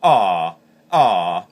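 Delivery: loud voiced ah with a hum in it; voiced goose moan through a call; hummed goose moan. Shown as a demonstration.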